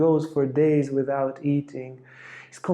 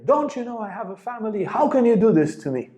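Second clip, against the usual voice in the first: a raised-pitch mock yell; 8 semitones higher than usual. Vivekananda protesting to Ramakrishna, a mild mock yell. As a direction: protest up high, half yelled. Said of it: "And I yelled at him".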